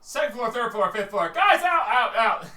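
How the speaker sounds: high, loud calls; calling out. Acted. Calling guys out of each floor, loud and high.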